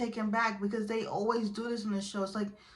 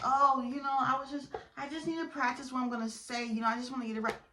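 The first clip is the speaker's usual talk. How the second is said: higher-pitched, hurried mimicry; mock-innocent higher babble; imitating a flustered excuse; 5 semitones higher, faster than usual. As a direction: ramble the excuse in a raised voice. Mimicking cheater's rambling excuse.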